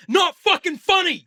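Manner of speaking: high, loud, slow verdict; emphatic disgust. Acted. Telling friend the film isn't funny, shouted exasperation. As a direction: hammer out each word in exasperation.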